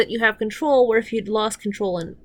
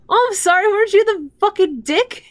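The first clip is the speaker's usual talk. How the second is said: angry rant; 10 semitones higher, 7 dB louder than usual. Real, not acted. Ranting at a show character.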